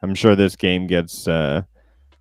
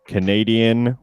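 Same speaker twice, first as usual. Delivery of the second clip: ordinary talk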